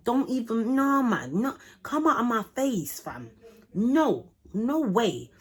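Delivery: indignant, emphatic refusal; outraged rejection. Retold or imitated. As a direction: shoo him away in disbelief. Scolding the crying boyfriend, acted out.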